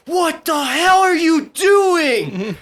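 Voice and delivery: high, loud, drawn-out imitation of an angry yell; screaming sergeant. Sergeant screams at a trainee who ran wrong, mock furious scream. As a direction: yell it like an outraged drill sergeant. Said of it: "start getting fucking screamed at".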